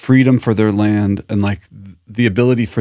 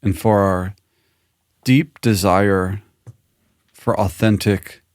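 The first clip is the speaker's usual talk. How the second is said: guided closing meditation. Real, not acted. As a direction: lead listeners gently through gratitude, unhurried. Leads a closing breath and gratitude.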